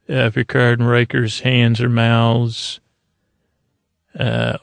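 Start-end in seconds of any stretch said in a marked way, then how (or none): none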